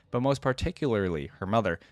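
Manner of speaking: ordinary talk, nothing marked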